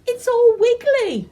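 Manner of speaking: put-on high and loud, delighted little girl; excited high girl's voice; giggly child. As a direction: squeal it like a thrilled child. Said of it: "she declared".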